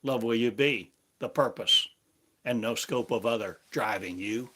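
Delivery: normal talking voice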